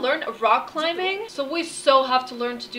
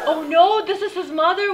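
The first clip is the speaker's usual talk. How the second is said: laughing, higher and louder oh no; alarmed laugh; 5 semitones higher, 5 dB louder than usual. Real, not acted. Gamer spotting the disapproving mother, laughing alarm. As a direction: caught out, half laughing.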